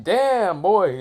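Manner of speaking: high-pitched amazed exclamation; impressed astonishment. Acted. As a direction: exclaim in stunned admiration, pitch leaping up. Zabuza stunned by the catch.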